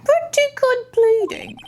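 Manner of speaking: high courtroom character. Acted. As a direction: pitch the line up high. Acted courtroom character, pitched high.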